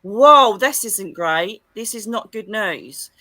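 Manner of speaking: loud "whoa", then slow and deliberate; slow, mock-horrified realisation; acted dismay. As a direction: react to bad news in drawn-out alarm.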